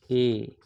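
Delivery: ए as a long, held "ay"; sounding out ए. Shown as a demonstration. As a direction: draw out ए for the learners. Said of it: "फिर एक आता है ए". (ए को लंबा खींचकर बोलें)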